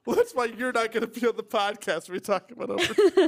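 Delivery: laughing with a hoarse wheeze; wheezy laugh. Real, not acted. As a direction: talk through a raspy wheezing laugh. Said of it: "I got a really bad wheezy laugh right now".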